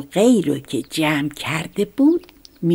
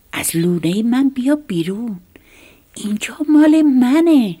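loud shout; 8 semitones higher, 7 dB louder than usual. A narrator's reading. Little mouse shouting at the snake in his nest, loud and high. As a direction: shout it, rising high and louder at the end. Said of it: "با صدای بلند گفت".

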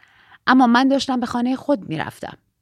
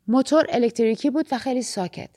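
plain reading voice

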